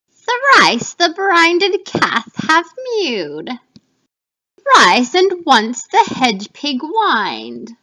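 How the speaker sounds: loud, slow, eerie witch chant; first witch. Acted. Teacher reading to class as loud, slow first witch. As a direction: intone it like a hag over a cauldron. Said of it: "This image will represent the first witch".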